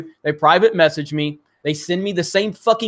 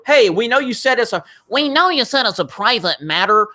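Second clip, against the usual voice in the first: mocking Team YouTube; 6 semitones higher than usual. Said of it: "they wanted to go".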